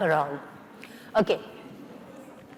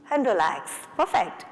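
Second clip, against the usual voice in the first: ordinary talk, nothing marked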